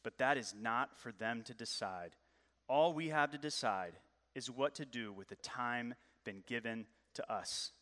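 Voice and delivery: lightly voiced grave wizard; a hint of solemn old Gandalf; wise Gandalf. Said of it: "And Gandalf the wise says".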